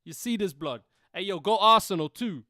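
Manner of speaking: high, loud London roadman; roadman British voice. Shown as a demonstration. Co-host showing off birthday greeting, roadman British voice. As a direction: hype him up in a British roadman accent. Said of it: "I could do a roadman British voice".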